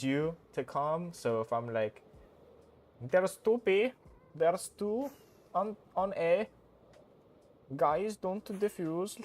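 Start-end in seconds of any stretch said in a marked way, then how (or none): 3.0-9.2 high, louder mock Indian accent; trolling Indian accent. Streamer trolling teammates with game callouts, mock Indian accent. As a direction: give the callout in a silly pitched-up Indian accent. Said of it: "I just deliver it in a troll way".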